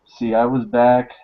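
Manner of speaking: ordinary delivery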